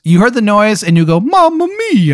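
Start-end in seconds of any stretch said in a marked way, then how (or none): 1.2-2.2 squeaky high cartoon Italian plumber yelp; Mario voice. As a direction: exclaim it like Mario wiping out on a kart. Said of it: "talking like an Italian man".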